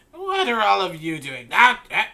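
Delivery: high, loud hag voice; hag snapping at girl. Acted. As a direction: bark at the girl like a crone. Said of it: "she says to the little girl".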